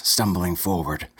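usual speaking voice

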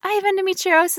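high little patient voice; playfully acted patient; mock patient. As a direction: confess it to the doctor in a high, put-on voice. Acted patient telling the doctor.

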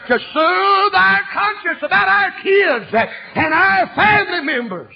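slow, high, loud sermon shout; full-force preaching. Acted. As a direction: cry it out to the church.

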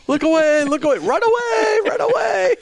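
high, hammed-up cries of warning; mock alarm cry. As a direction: shriek it out as a joking warning.

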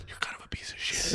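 unvoiced whispered insult; pretend whisper. High schoolers too scared to insult the big guy, whispered. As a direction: whisper it so he can't hear. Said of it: "no one wanted to be like".

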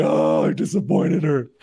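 mock despair. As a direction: play up the self-pity. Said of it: "I was like".

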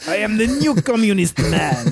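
grandly declared in mock Cuban accent. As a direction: proclaim it as a comic Cuban communist. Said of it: "we Cubans".

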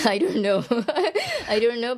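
amused, laughing as you admit it. Real, not acted. Laughing reply to interviewer's question.

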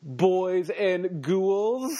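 slow, drawn-out creepy horror host; Crypt Keeper. Pretend. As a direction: stretch each word like a ghoulish host. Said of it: "I was trying to be the Crypt Keeper".

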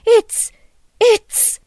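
high, loud broken-off stammer; too furious to finish; trailing off speechless. A narrator's reading. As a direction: stall on the word, outraged. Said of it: "Missus Hughes's voice trailed off".

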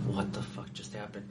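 breathless whispered disbelief; stunned whisper. Retold or imitated. To himself after the guard steps in, stunned whisper. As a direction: whisper it to yourself in shock. His stunned inner reaction.

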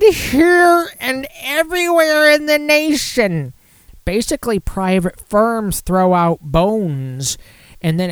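high, slow, strained villain impression; Bane voice. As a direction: drag the words out like a movie villain. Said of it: "now I'm starting to sound like Bane".

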